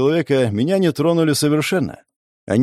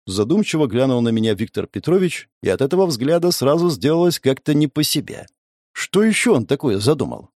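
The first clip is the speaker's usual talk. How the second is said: ordinary delivery, nothing marked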